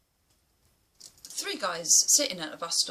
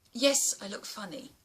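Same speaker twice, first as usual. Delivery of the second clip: ordinary talk, nothing marked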